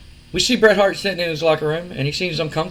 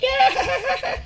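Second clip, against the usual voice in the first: crowd cheer. Retold or imitated. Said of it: "the crowd like".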